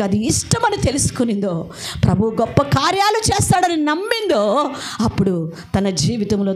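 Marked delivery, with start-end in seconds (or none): none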